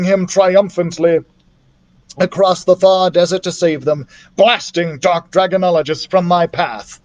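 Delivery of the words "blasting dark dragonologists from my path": ordinary delivery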